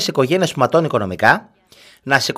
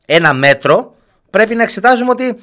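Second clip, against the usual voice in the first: emphatic raised voice; 10 semitones higher, 6 dB louder than usual. Real, not acted. Radio host's emphatic point.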